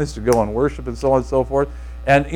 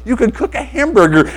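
imitating a giddy friend, higher pitched; giddy big smile; 7 semitones higher than usual. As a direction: gush through a big grin. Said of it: "you see how I am right now?".

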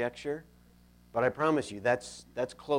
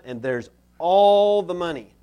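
"all" drawn out and much louder; boastful, loud, higher emphasis on "all"; stress on "all"; 4 semitones higher, 12 dB louder than usual. Acted. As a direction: punch and stretch the word "all". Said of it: "Emphasis on all".

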